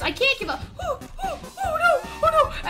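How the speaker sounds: ordinary talk, nothing marked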